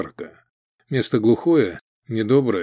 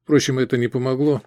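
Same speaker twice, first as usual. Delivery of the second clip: plain reading voice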